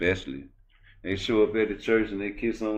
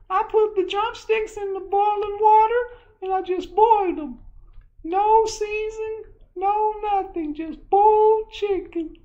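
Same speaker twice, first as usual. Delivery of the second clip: very high, louder sitcom-couple voices; 6 dB louder than usual. Retold or imitated. A husband asking his wife for plain boiled chicken in a hammed-up voice. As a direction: ham up a sweet husband and wife over dinner. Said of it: "I just wanna go around and talk like that".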